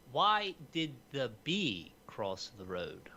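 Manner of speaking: slow joke setup; drawn-out, deadpan delivery; telling a dad joke. Acted. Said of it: "this joke that is just gonna slay".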